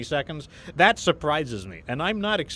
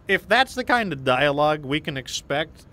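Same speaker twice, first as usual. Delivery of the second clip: normal talking voice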